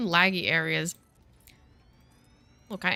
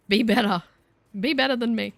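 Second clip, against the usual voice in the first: louder, higher plea; mock parental exhortation; 4 semitones higher, 5 dB louder than usual. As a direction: urge the kids emphatically, half in jest. Parent exhorting kids, acted.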